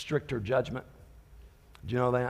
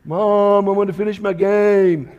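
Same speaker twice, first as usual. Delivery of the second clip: put-on whiny kid; 7 semitones higher, 13 dB louder, slower than usual. Kid answers mom in skit.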